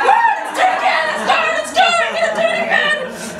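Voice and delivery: high, loud, sing-song whoop; sugar-fuelled cheer. Retold or imitated. Narrator cheering after chocolate and Coca-Cola, high sing-song whoop. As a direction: belt it out like a kid on a sugar rush. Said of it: "I was like".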